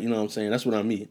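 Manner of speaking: ordinary talk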